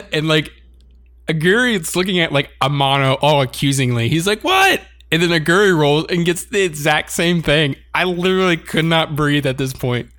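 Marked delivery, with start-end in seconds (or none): 4.4-4.8 very high startled squeak; innocent surprise. Retold or imitated. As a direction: play dumb, caught out. Character caught, retold.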